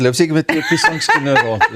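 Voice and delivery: normal talking voice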